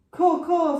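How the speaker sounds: a harsh high crow's caw, loud; bird screech. A narrator's reading. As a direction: screech it like a giant crow. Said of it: "screeched the bird".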